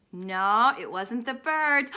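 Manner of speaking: usual speaking voice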